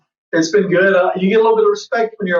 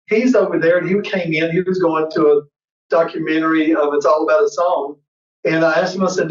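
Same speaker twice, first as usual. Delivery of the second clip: usual speaking voice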